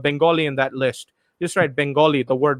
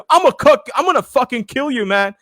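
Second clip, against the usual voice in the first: a higher, louder imitated threat; mock threat; 7 semitones higher, 4 dB louder than usual. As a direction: ham up the jungle guy's threat, a little higher and louder. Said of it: "And this guy says". (imitating a threatening stranger, higher and louder)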